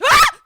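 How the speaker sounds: very loud, high shriek; piercing acted yelp of fright; sudden scream. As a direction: cut in with a startled scream. Acts sudden scream in story.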